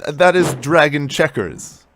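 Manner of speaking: exasperated, forceful correction; indignant protest. Acted. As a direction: call out the cheat loudly. Player disputes goblin's illegal move.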